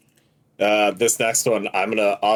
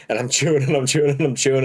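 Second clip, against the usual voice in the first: faster, laughing through the repeated words; faster than usual. Real, not acted. Retelling the gross sandwich to friends, breaking into laughter. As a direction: crack up while repeating it. Repeated chewing line in story.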